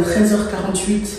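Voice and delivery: ordinary talk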